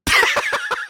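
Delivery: real high laugh breaking into the story; a sudden squeal of a laugh; high burst of laughter. Real, not acted. Storyteller cracks up retelling the gravy mix-up, real high laugh.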